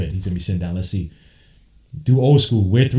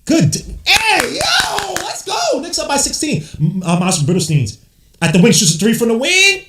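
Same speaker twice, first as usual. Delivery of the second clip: hyped fan commentary climbing into high, loud shouts; excited basketball call building to a yell; live play-by-play; 6 dB louder, slower than usual. Real, not acted. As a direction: call the game, then explode on the big shot.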